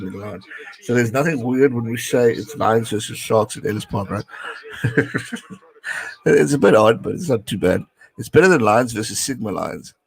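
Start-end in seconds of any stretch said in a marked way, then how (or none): 4.3-5.5 soft chuckle; amused laugh. Real, not acted. Streamer amused by Lions versus Sharks, chuckling. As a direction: laugh softly to yourself. Laughs at his own remark.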